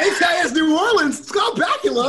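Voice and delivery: high, loud, laughing shout; hyped-up mock excitement. Co-host jokingly hyping an actor's show, mock excited. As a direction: shout it like a thrilled superfan.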